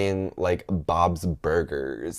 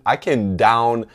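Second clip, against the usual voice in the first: ordinary talk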